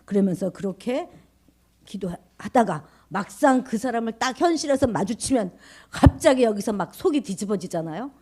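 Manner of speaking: normal talking voice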